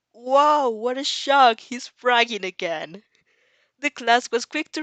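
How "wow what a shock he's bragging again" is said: loud, mock-astonished sarcasm; sarcastic. Real, not acted. Narrator mocking Katsuki's bragging, loud sarcastic wow. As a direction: fake shock at the obvious.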